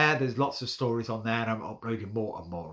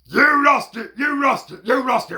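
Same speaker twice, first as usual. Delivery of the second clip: high, loud angry shouts; blaming dogs; 11 semitones higher, 11 dB louder than usual. Acted. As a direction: dogs barking the blame at cats. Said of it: "chases after it, saying".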